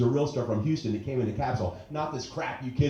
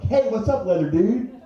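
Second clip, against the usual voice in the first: chirpy greeting; 11 semitones higher, 6 dB louder than usual. Retold or imitated. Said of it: "and I'm like".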